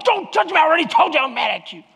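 high, rapid mock-angry outburst; imitating angry wife. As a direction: act out an exasperated wife telling him off. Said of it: "to prove how mad she is at me".

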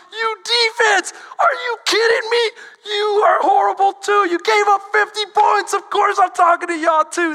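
loud, high angry coach yelling at the defense; a coach tearing into his defense after a blowout, yelling; coach chewing out. Retold or imitated. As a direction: bark at the team like a furious coach. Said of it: "reading you the riot act".

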